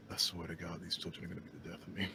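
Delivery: fast whispered grumble under the breath; exasperated whisper. Acted. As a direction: mutter it to yourself, fed up, fast. Exasperated aside about the children.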